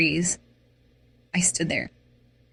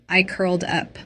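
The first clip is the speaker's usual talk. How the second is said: usual speaking voice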